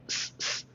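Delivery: स as a voiceless hiss; hissed letter. Shown as a demonstration. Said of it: "आप अक्सर ऐसे आवाज़ निकालते होंगे". (बिना आवाज़ के स की सीटी)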